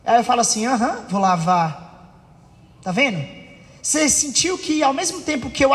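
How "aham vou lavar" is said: low, dismissive brush-off; half-hearted agreement that belittles. Acted. Son answers his mother about washing dishes, dismissive. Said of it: "eu desmereci a minha mãe".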